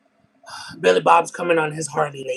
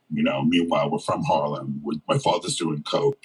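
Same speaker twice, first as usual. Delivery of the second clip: much lower, far-off imitation of the deep-voiced woman; 12 semitones lower than usual. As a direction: drop low into her raspy storytelling. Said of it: "talks like this".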